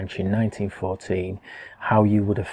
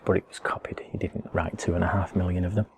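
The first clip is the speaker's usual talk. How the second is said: aside; 2 semitones lower, 7 dB quieter than usual. Real, not acted. Confiding aside about the king's message.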